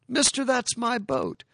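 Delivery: put-on high, eager child's plea; a boy claiming his lost toy; pleading little boy. Boy to pawnbroker, high child's plea. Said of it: "he went into the pawnbroker and said".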